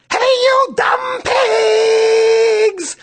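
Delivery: huge high drawn-out yell at the pigs; hollering, stretched slow. A narrator's reading. Girl hollering at the lazy pigs, huge drawn-out yell. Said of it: "Then Megan yelled".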